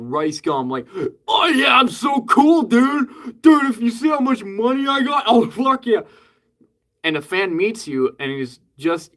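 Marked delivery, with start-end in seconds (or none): 1.3-6.0 high, shouted bro bragging; douchey YouTuber voice. Pretend. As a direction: boast like an obnoxious clout bro. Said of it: "those douche tubers, like Logan Paul".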